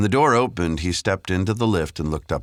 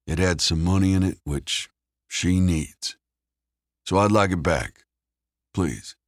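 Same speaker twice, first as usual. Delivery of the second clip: lightly voiced American, slightly raised; Caleb, controlled; 5 semitones higher than usual. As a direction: make a measured, polite demand. Figure in narrated story.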